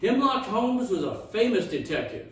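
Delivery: ordinary delivery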